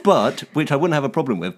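ordinary delivery

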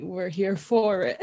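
ordinary talk

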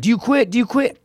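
high-pitched, repeated mock challenge; badgering instructor. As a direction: hound the recruit, imitating an instructor. Instructor hounding drowning recruits.

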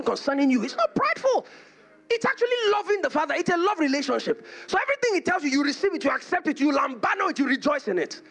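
high-pitched, urgent pulpit exhortation; impassioned preaching. Real, not acted. Preacher urges believers to value themselves, impassioned preaching. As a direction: preach it with rising fervour, voice pitched well up.

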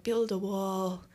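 put-on slow, half-hearted American accent; failed American accent. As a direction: attempt an American accent on purpose badly. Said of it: "I can't do an American accent".

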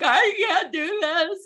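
high, slow, mock-tearful wail; mimicked bawling mom. Mom falling apart in her kitchen to her realtor, mock-tearful high wail. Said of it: "a mom just bawling".

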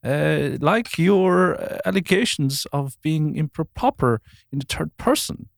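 hammed-up upper-class English accent; posh Queen impression. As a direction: pronounce it like the Queen addressing the nation. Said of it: "offending someone with that accent".

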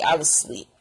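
low, matter-of-fact insistence; low-pitched, settled claim; low statement. Real, not acted. Repeats claim, low then squealed.

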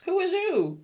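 high, loud yell of disbelief; mock yell at the screen. Friend yelling at a movie character on the phone, high mock yell. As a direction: shout it up high like a scandalized viewer.

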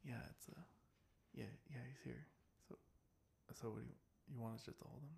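hushed phone whisper. Retold or imitated. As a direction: mutter it into the phone, covert. Clerk secretly on the phone.

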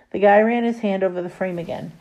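ordinary delivery

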